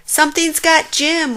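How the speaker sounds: high, loud panicked scream. A narrator's reading. Warthog seeing something grab Jim's leg, screaming. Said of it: "Warthog screamed".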